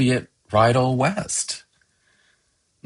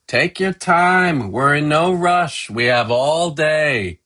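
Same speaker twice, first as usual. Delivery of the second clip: blurting out; 7 semitones higher, 4 dB louder than usual. A narrator's reading. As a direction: sing it out to people far off. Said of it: "Mommy blurts out".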